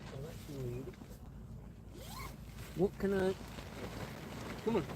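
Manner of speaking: ordinary delivery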